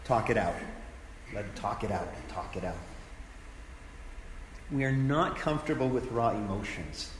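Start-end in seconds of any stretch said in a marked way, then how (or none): 0.1-2.8 softer and softer, calm and knowing; wise gentle reassurance. Retold or imitated. Pastor gently urging a grieving person to talk, soft and fading. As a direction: nod wisely and ease them into it, fading to a hush. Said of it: "I nod my head wisely and say".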